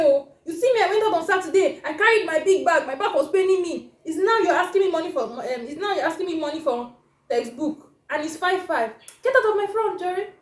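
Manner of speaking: loud, high, fast indignant scolding; exasperated parent telling a child off; taken-aback parent. Acted. Father scolding son over last-minute textbook money, indignant outburst. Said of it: "you're taken aback".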